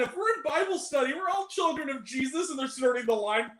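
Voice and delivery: high-pitched Vine reenactment; old Vine impression. Recalling a Bible study Vine to friends, high mock imitation. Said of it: "that old Vine".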